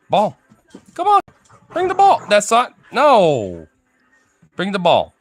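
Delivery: loud, high-pitched dog commands; calling out commands. Real, not acted. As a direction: call sharply to an excited dog.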